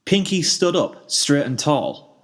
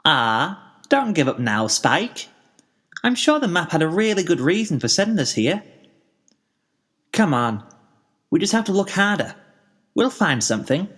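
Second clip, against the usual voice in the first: higher bubbly pony voice, upbeat; Pinkie, encouraging; 5 semitones higher than usual. A narrator's reading. Pinkie encouraging Spike not to give up. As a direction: cheer up a tired friend.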